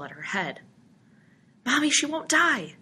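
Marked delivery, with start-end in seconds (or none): 1.6-2.8 put-on high-pitched child's yell; yelling boy. A narrator's reading. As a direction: yell it like an upset little boy. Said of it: "he yelled".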